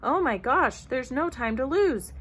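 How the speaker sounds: alarmed realisation. A narrator's reading. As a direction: blurt it out in urgency. Second bear realises the danger.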